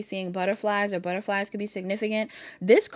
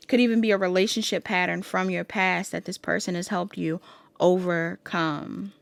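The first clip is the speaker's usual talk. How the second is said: ordinary talk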